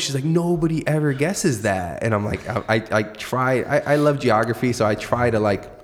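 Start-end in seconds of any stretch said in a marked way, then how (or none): 0.2-2.0 stoked. Retold or imitated. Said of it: "she was so stoked".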